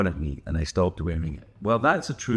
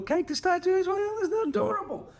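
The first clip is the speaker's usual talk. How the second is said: cutesy, very high squeaky voice; high-pitched gushing. Pretend. Showing off friends' matching tattoos, playful high-pitched cooing. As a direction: gush as if cooing over something tiny.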